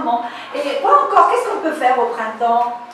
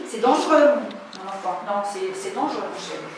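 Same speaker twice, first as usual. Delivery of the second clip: gentle warning; 6 semitones lower, 8 dB quieter, faster than usual. Real, not acted. Teacher gently warns child off motorbike.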